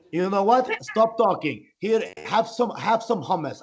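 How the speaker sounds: put-on Iranian accent of a pushy host; mock Iranian accent. Newly Iranian friend offers hummus, mock Iranian accent. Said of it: "got back three percent Iranian".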